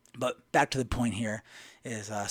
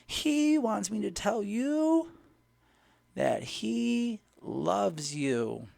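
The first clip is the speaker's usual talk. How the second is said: put-on entranced old lady; 9 semitones higher, much slower than usual. Gym lady delivers God's message, slow imitated trance voice. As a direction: stretch the message out, word by word. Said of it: "She's like".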